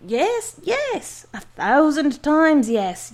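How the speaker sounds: high, loud joyful yell. Acted. Giant confirming to the doctor, joyful yell. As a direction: yell the yeses with glee. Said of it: "yelled the giant".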